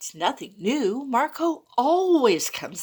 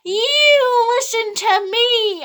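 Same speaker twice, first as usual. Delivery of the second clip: much higher, louder, slow cat voice; one cat bosses the other, loud and high; bossy cat; 11 semitones higher, 7 dB louder, slower than usual. Acted. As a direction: lay down the law as a pushy cat. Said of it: "I heard one of 'em say".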